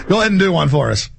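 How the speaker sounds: ordinary delivery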